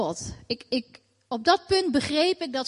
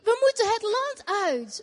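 high, strained cry; retold frantic cry to leave the country; retold shout; 7 semitones higher than usual. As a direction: call out the urgent plea, pitched up high. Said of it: "Omdat ik dus riep".

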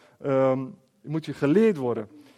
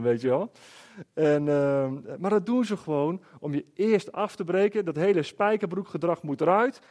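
normal talking voice

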